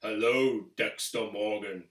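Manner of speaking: low, slow, menacing killer's hello; creepy Trinity greeting. Retold or imitated. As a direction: drop low and draw it out like a calm killer. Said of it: "he's like".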